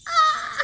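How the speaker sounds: high sudden yelp; emotional yelp. Real, not acted. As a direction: shake off the tears. Shaking off emotion.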